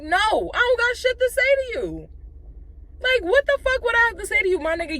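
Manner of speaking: high-pitched, exasperated retort; indignant refusal. Retold or imitated. Angry refusal in retold quarrel.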